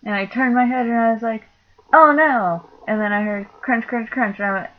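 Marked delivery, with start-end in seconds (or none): 1.9-2.7 high, loud alarmed "oh no"; startled cry. Retold or imitated. Caught in the wind at work, a loud startled oh no. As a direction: yelp it as the cigarette flies off. Cigarette blown away, I was like.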